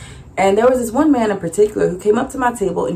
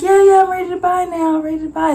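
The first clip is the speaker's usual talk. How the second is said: returning customer; 11 semitones higher, faster than usual. Retold or imitated. Woman returning voiced.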